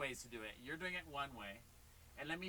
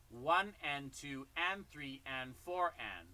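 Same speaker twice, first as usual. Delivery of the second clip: slow, even counting out the beat; counted demo; 2 semitones lower, slower than usual. Shown as a demonstration. Drum teacher counting the beat for a student, slow and even. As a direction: count it slowly so the student can follow. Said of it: "It goes".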